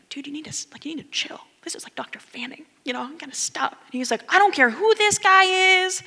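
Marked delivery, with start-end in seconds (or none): 0.1-3.7 whispered warning to a classmate; hushed, urgent plea to calm down. Retold or imitated. Hushing classmate during lecture. 4.3-6.0 high, loud brush-off; loud, dismissive student retort; defiant retort. Retold or imitated.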